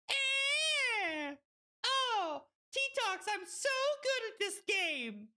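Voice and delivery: very high-pitched squeal; exclaiming at play. Real, not acted. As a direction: squeal it out over the game. Exclaiming over own game play.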